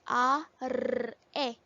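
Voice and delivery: A as "ah", R as a trilled "rrr", E as "eh"; letter sounds. Shown as a demonstration. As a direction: sound out "A, R, E" slowly, one sound at a time. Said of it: "That sounds".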